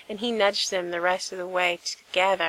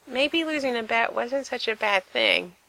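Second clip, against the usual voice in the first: plain reading voice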